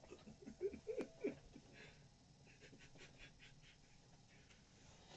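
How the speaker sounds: long, breathy, high wheezing laugh; real helpless fit of quiet laughter. Real, not acted. Listener laughs at the joke.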